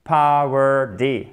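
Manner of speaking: very slow "Power D", t sounded as d; slow teacher model of "Power D"; slow syllable split. Shown as a demonstration. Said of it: "Le te, c'est presque comme un de".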